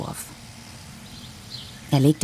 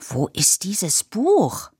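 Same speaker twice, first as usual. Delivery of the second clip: agitated call; 4 semitones higher, much slower than usual. Acted. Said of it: "rief Papa aufgebracht".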